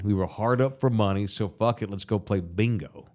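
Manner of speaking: ordinary talk, nothing marked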